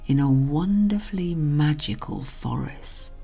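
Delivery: usual speaking voice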